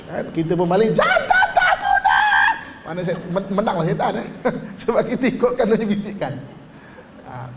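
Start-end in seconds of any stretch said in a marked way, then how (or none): 0.9-2.2 shrieking, much higher furious shout; angry yell. Acted. Wife giving in to the whisper and shouting at her husband. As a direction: scream it at the husband.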